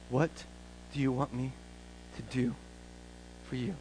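very soft, spaced-out words; gentle question. Acted. Jesus asking the blind beggar what he wants, soft and halting. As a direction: ask it softly, pausing between phrases.

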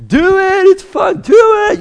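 high, loud mocking chant of the crowd; egging on. People below urging the kid, high mocking chant. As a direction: taunt him like the line below. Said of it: "You got people".

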